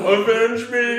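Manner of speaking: hammed-up high dying plea; overacted death scene. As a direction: play a dramatic dying hero.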